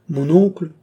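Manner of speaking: high, loud appeal; agitated protest to an uncle; agitated reply. A narrator's reading. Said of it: "répliqua le duc avec une agitation".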